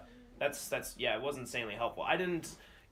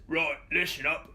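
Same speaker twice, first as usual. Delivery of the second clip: slow, louder gruff Kron's accent; 4 semitones higher, 6 dB louder than usual. Shown as a demonstration. Player showing his character's catchphrase in Kron's accent. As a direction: snap into Kron's accent to call a group to order. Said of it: "I specifically say in Kron's accent".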